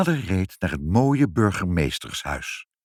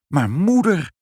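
put-on high, loud child's cry; child exclaiming; 10 semitones higher, 6 dB louder, slower than usual. A narrator's reading. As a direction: call out to mother in astonishment. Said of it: "riep Ibbeltje". (verbaasd uitroepend, hoog en luid)

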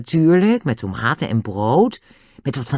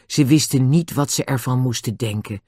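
ordinary delivery, nothing marked

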